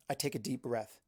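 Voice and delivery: plain reading voice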